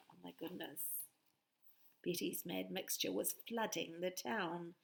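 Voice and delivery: usual speaking voice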